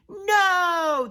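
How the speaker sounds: high, loud refusal; crowd yell. Acted. Crowd answering Pipsqueak, yelled. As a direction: yell back as one crowd. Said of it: "the crowd yelled".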